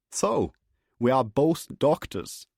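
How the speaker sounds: a friendly German doctor greeting his guest; chirpy German accent. Acted. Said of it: "Meisner had a thick German accent and sounded chirpy".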